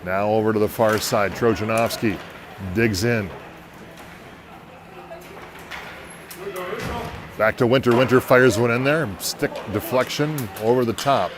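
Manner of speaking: brisk hockey announcer calling the action; live play-by-play. Acted. Announcer calling the hockey game for listeners, in brisk play-by-play. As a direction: call the game as it happens, rising on big plays.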